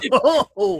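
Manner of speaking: high burst of real laughter. Real, not acted. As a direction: crack up at the absurd story. Laughing at the scissors video.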